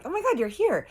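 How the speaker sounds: high-pitched, gushing welcome; excited greeting. Retold or imitated. Narrator waves at Harley acting innocent, excited greeting. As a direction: greet them as if delighted, pitch way up.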